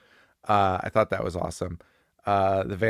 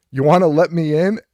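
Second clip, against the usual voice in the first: louder, higher acted question; calling at the door; 5 semitones higher, 8 dB louder than usual. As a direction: call out to be let in. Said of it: "shows up to the door and is like".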